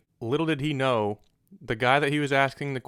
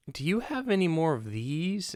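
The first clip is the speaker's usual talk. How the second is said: lady customer; 5 semitones higher than usual. Retold or imitated. Lady asks seller for more ammo, mimicked in lilting voice. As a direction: put on an exaggerated high customer voice.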